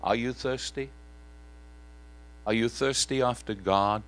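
ordinary talk, nothing marked

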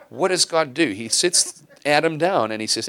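ordinary delivery

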